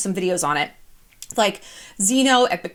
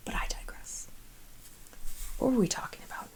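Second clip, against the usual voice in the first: hushed whispered aside; conspiratorial whispered aside; 14 dB quieter than usual. Real, not acted. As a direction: drop to a secret whisper.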